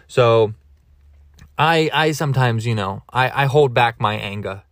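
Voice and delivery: ordinary talk, nothing marked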